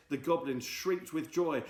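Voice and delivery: ordinary delivery, nothing marked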